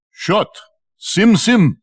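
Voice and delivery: a hint of the captain barking at the rock; captain's command. Captain closing the cave behind his troop, mild command.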